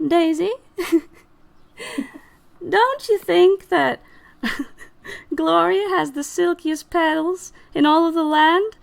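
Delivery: hammed-up high sidekick, half laughing; flattering Violet. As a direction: prompt a friend to join the flattery. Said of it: "Violet turns over to Daisy".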